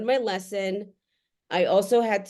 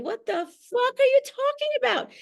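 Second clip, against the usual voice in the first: incredulous; 13 semitones higher than usual. Real, not acted. Incredulous rant at TV dad.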